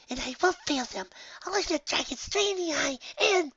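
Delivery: high, bright resolve; determined pony voice. Acted. Pony vowing to face the dragon, high and resolute. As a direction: swear to face the dragon head-on.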